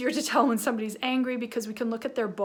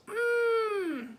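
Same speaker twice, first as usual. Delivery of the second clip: long, high angry "mmm"; angry hum; 11 semitones higher than usual. Shown as a demonstration. Teacher showing kids a small angry mouth, angry hum. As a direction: strain out a mock-furious squeal through closed lips. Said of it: "Now, if I'm angry".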